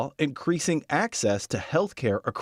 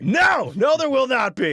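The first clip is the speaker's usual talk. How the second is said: higher, louder insistent no; emphatic denial; 11 semitones higher, 5 dB louder than usual. Real, not acted. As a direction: shut the idea down firmly. Insisting against co-host.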